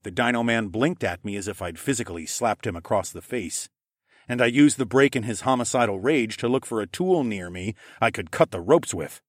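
plain reading voice

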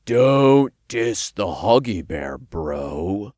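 slow, deliberate imp warning. A narrator's reading. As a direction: warn a bro with drawn-out words. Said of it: "Todd warned".

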